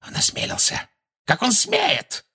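hissed disbelief leaping to a high screech; outraged cries. A narrator's reading. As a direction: sputter with indignation, then squeal. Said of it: "невпопад выкрикивала Белатриса".

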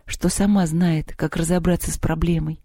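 ordinary delivery, nothing marked

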